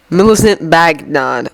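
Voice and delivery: ordinary delivery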